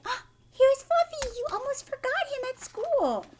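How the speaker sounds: high, loud mock-cheery driver; bus driver's cheery line. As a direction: hand over the pet with a high, showy flourish. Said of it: "But the bus driver's thinking".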